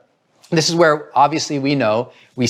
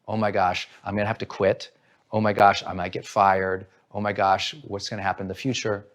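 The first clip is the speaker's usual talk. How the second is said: anxious worrying; 7 semitones lower, 7 dB quieter than usual. Retold or imitated. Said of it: "And then they interpret it".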